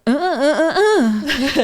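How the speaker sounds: put-on loud, high, insistent toddler "uh uh uh"; imitating toddler grunts. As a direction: grunt like a baby who wants something. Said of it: "it's like".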